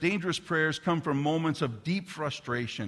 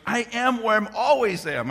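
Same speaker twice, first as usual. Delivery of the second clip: exasperated daughter; 8 semitones higher, 7 dB louder than usual. Retold or imitated. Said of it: "she goes".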